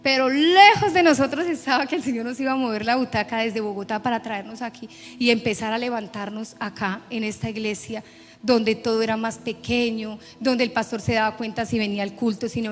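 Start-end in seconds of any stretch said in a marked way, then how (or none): none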